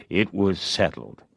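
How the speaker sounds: plain reading voice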